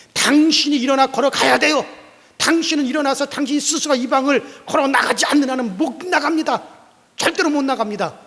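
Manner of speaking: high, loud firm declaration; stern insistence. Acted. Pastor telling the lame man he must walk out, loud. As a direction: lay down the rule.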